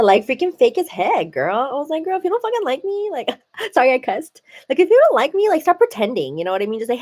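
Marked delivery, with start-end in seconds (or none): 2.0-3.1 high, quick sassy retort; sassy callout. Retold or imitated. Telling off the fake coworker, quick and sassy. 4.8-6.4 high, quick sassy retort; sassy callout. Retold or imitated. Telling off the fake coworker, quick and sassy.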